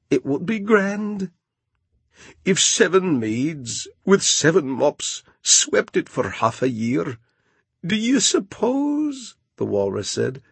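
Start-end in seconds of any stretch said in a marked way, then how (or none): 0.1-1.3 character voice. Acted. Walrus and Carpenter speak. 2.4-9.3 pompous walrus. Acted. Walrus speaks.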